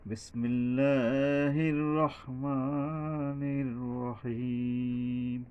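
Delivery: very slow, drawn-out chanted recitation; tajweed chant; Quran recitation. Shown as a demonstration. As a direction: chant each word, holding the long vowels.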